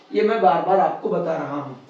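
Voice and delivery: ordinary delivery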